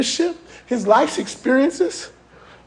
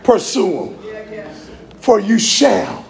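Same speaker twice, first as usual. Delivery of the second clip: deep, calm divine command; voice of God; 7 semitones lower than usual. Acted. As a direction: speak as God sending David out. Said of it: "guess what God says to him".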